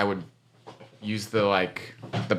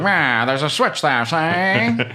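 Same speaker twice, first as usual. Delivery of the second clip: higher, slow mock used-car salesman; fifties car salesman; 8 semitones higher, 4 dB louder, much slower than usual. Friend guessing the demon's voice, hammed-up fifties salesman. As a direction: ham up an old-time salesman, drawn out and pitched up. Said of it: "sound like a nineteen fifties used car salesman".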